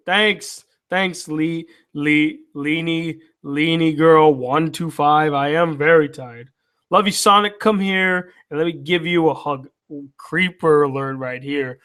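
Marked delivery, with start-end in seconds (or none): none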